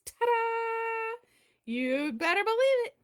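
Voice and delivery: high, loud ta-da fanfare; triumphant. Acted. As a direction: burst out in triumph. Triumphant catchphrase.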